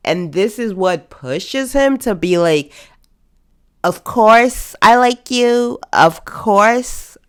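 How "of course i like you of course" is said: hammed-up, higher and louder love confession; mock declaration. Viewer mocking Ty's confession to Champ, hammed-up. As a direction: ham up Ty's dramatic confession.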